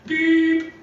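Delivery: high loud beep sound; imitated beep. Comic mimicking an answering-machine tone, high and loud. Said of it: "at the sound of the beep".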